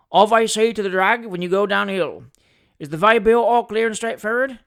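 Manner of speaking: loud coachman's character voice; hearty old coachman checking arrangements; old coachman, businesslike. Acted. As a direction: speak up like a stagecoach driver. Old coachman questions the attorney.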